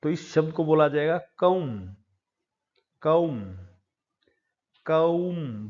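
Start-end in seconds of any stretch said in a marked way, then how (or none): 1.2-5.7 कौम said as "koom", with no b at the end; silent b. Shown as a demonstration. Teacher drilling comb for students, silent b. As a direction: model कौम without the b sound. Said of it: "ब नहीं बोला जाएगा".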